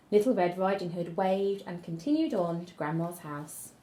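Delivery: plain reading voice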